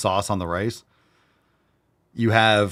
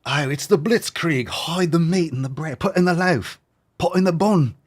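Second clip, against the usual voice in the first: high, hammed-up cries of alarm; mock panic; 9 semitones higher, 3 dB louder than usual. Villager warning of the Blitzkrieg, mock-panicked high voice. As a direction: urgently order everyone to hide it, pitch climbing.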